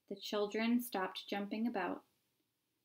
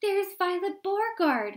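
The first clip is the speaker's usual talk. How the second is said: high, loud crowd shout; 10 semitones higher, 7 dB louder than usual. A narrator's reading. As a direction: shout out her name from the crowd. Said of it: "he heard someone shouting".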